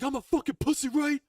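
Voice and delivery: high furious yell, mocking the insult; screaming in anger. Brother to brother in a park fight, furious yell. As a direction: scream it back at him, enraged. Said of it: "it escalated to us screaming".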